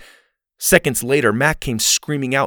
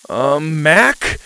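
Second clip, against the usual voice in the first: hesitant, louder rising call; 3 semitones higher, 6 dB louder than usual. A narrator's reading. Boy calling his friend from the hole, raised uneasy voice. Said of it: "he called out".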